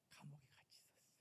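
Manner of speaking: soft intimate whisper; hushed whisper. Acted. Preacher whispering to the congregation that God stayed in prison, hushed. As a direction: lean in and whisper it close.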